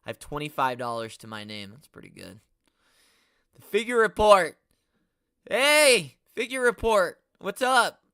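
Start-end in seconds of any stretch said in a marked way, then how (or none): 3.5-8.0 loud, high hype shout-out; loud hyped greeting to a fellow channel; calling out greeting. Real, not acted. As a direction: hail them like an announcer.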